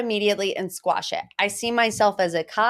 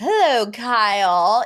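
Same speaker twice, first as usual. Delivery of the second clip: imitating Dorit; 2 semitones higher, 4 dB louder, slower than usual. Host imitating Dorit greeting Kyle, passive aggressive. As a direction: greet her with icy sweetness. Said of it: "That's what she says".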